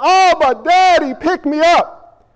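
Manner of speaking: put-on loud, high childlike plea; yelling. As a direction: cry out to daddy, drawing out the last words. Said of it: "you run to God and you yell".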